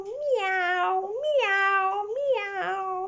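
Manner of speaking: high mock meow like a cat. Woman mimicking her cat's meows to her viewers. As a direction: imitate the cat asking to go out. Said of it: "il n'arrêtait pas de faire miaou".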